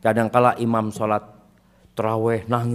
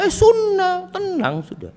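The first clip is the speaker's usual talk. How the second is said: 1 relieved shirker; slower than usual. Retold or imitated.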